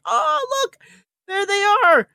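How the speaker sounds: very high, thrilled squeal; excited. Retold or imitated. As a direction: squeal with fan delight. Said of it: "I was like".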